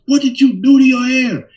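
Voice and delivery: a mother horrified at her son's hair; shocked mother. Retold or imitated. Mother seeing the straightened hair, high loud shocked cry.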